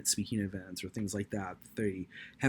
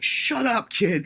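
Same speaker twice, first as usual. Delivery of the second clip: mock scold; 14 semitones higher, 13 dB louder, much slower than usual. Imagined harsh scolding.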